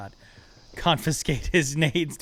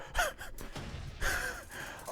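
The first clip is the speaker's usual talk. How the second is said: breathless shriek laugh; 10 dB quieter than usual. Real, not acted. After near grenade death.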